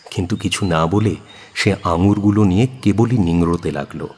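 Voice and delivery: ordinary delivery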